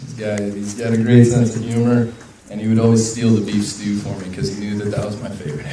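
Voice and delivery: normal talking voice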